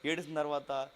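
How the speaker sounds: usual speaking voice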